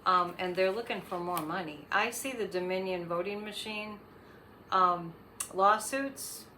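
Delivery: ordinary talk, nothing marked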